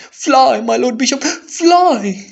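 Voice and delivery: high, loud frantic cry; panicked warning. Acted. Servant urging the bishop to flee, frantic and loud. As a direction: shout fly as if the rats are behind you. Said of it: "he was pale as pale could be".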